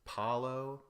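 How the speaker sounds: ordinary talk, nothing marked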